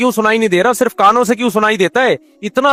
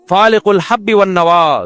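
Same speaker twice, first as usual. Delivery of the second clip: Quranic recitation; slower than usual. Acted. Quoting Quran in sermon.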